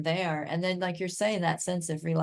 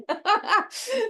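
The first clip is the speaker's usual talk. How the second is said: bright laugh, high pitch; high delighted laugh; 4 dB louder than usual. Real, not acted. Laughing at her own story.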